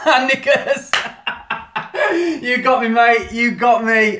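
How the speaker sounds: high, loud helpless laughter; real laughing fit, caught out by a joke; laughing hard. Real, not acted. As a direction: crack up and squeal through the words. Falls for a prank name, laughs.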